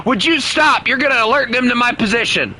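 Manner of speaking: high, urgent exasperation; exasperated protest. Real, not acted. Player fearing teammate will reveal his position, high exasperated protest. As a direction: plead with rising urgency. Pleading with teammate shooting at him.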